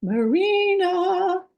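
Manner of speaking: long drawn-out high call of the name. Real, not acted. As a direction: call the name across a room. Calling out for absent volunteer.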